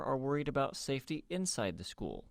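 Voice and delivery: ordinary talk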